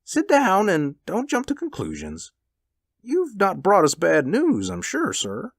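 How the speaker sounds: high-pitched, urgent reassurance easing down; anxious husband. A narrator's reading. Husband to wife, then visitor, anxiously reassuring. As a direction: start high, settle into a hopeful appeal.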